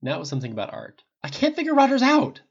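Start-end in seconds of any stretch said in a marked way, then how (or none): 1.2-2.4 much higher and louder outburst; blurted out. A narrator's reading. Tony blurts his frustration to Pepper on the phone, loud. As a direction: burst it out, cutting her off. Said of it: "Tony eventually blurted out".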